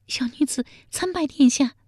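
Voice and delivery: high-pitched, fearful maid's greeting; frightened palace maid. A narrator's reading. Palace maid kneels before Zhao with the baby, frightened. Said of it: "一脸惊恐地说".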